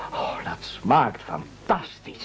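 breathy, slow whisper of delight; savouring whisper. Acted. Cook savouring an imagined dish, whispered slowly. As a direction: whisper it like tasting something delicious.

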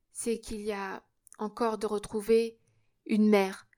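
plain reading voice

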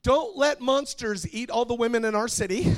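high, very loud mock pleading; child's shouted prayer. Younger daughter praying for Daddy's attention, high loud shout. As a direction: belt it out like a little kid showing off.

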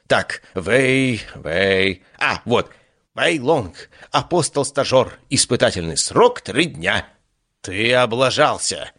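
high, theatrical boastful deity; vain god voice. Acted. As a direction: play a vain, showy god. God voice reads notebook.

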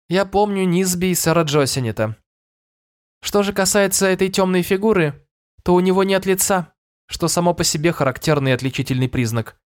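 a knowing fairy judging. A narrator's reading. Twisk answers the king. (голос феи)

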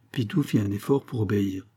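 plain reading voice

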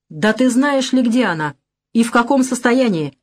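ordinary delivery, nothing marked

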